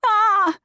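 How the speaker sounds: high, loud cat scream; cat's mock scream. A narrator's reading. Cat throws up its paws, loud high scream. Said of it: "The cat threw up its paws".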